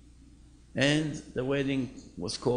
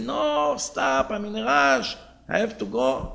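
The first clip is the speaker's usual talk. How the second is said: high and louder, urgent, hurried; alarmed protest; 8 semitones higher, 5 dB louder than usual. Retold or imitated. Old man begging the driver to stop, urgent raised voice. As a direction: stop him, frantic.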